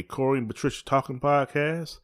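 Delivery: ordinary delivery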